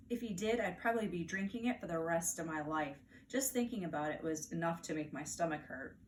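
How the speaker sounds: plain reading voice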